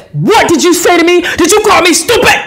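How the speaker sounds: high-pitched mock-furious accusation; put-on, sputtering indignant demand; retold spitting rage. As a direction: imitate an official flying off the handle. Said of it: "And he's spitting".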